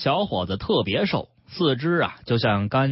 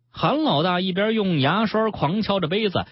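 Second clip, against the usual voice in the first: plain reading voice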